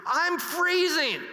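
high, slow, strained cry held inside; silent inner scream. Retold or imitated. Freezing man screaming inside his head at the captain, high slow strained cry. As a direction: stretch it out high like a scream nobody hears. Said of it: "in my head, I'm screaming".

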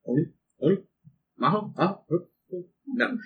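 ghostly chant, rising and fading; eerie whisper. Acted. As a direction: breathe it like a spooky spirit. Spooky whisper voice in narration.

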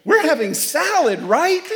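put-on high, loud sassy girl voice; mock the girl from the pizza ad; teen girl in commercial. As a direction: pert teenage daughter. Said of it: "the girl walks in and goes".

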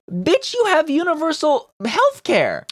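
very high, drawn-out indignation; outraged retort. Real, not acted. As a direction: squeal it in slow disbelief. Outraged retort to Bloc leader's claim.

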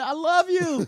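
high, loud mock cry of I love you; the woman's farewell, hammed up high; imitating the woman. Retelling the dog video, high mock cry. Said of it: "She said".

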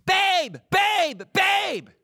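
very high, loud mock scream; imitated screaming. Wife waking husband in the middle of the night, imitated high screaming. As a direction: screech each word like a wife in alarm. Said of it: "my wife was screaming".

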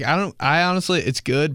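usual speaking voice

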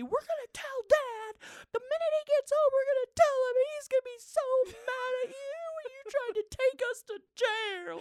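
very high, loud, tearful mock tantrum; kids yelling. Mom mimics kids threatening to tell Dad, tearful yelling. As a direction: imitate outraged children wailing threats at full pitch. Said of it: "They're yelling at me".

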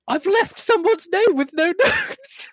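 high, slow laughing confession. Real, not acted. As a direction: laugh through the admission. Laughing at own mistake.

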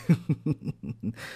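a short smug laugh, falling away; tiger's laugh. A narrator's reading. Tiger laughs at the tortoise he thinks he'll beat. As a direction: chuckle at the slow tortoise. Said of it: "the tiger was laughing to himself".

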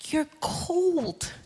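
hushed, gentle pointing-out; hushed divine verdict. Acted. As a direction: say it quietly, as a private revelation. The Lord gently pointing out coldness.

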